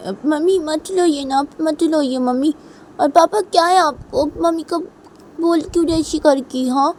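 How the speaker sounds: put-on high, loud child confronting father; indignant little daughter. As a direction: defend mother in a child's voice. बच्ची पिता से सवाल करती है. (पापा पर नाराज़ छोटी बच्ची)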